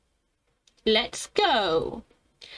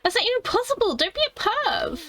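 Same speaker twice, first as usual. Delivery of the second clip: high, indignant retort; a girl scolding a creepy friend, high and indignant; 12 semitones higher than usual. Acted. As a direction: scold him like a flustered girl. Reacting as character, high.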